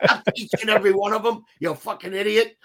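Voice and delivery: scolding gruff enforcer, laughing through it; hammed-up tirade at a bartender; imitating the enforcer yelling at the dumb bartender; mock angry mobster. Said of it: "He goes".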